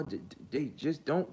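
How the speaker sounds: normal talking voice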